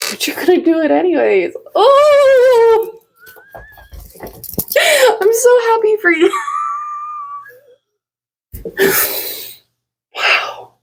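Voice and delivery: breaking into happy tears, pitch jumping up; tearful joy. Real, not acted. Spiritual reader overjoyed for her viewers, tearful and laughing.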